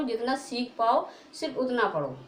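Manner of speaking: ordinary talk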